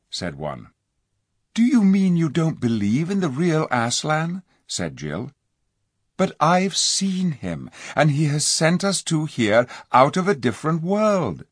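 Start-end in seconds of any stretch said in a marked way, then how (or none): 1.5-11.4 put-on high, pleading girl's voice; eager young girl. A narrator's reading. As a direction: coax them, bright and urgent. Jill protests.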